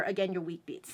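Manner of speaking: ordinary talk, nothing marked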